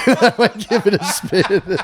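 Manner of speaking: cracking up at own dark joke; laugh-soaked slow repeat. Real, not acted. Joke about Mein Kampf.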